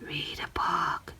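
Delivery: breathless little whisper; inner whisper. Acted. The little voice in his head on a rainy day, a soft whisper. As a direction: whisper it like a voice inside your head. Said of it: "a little voice in my head tells me".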